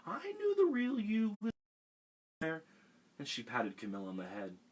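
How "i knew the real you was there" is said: fond. A narrator's reading. Old woman affectionate.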